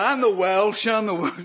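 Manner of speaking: loud, high, laughing retort; loud protest. Retold or imitated. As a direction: shout it back to the heckler, laughing. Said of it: "So I said".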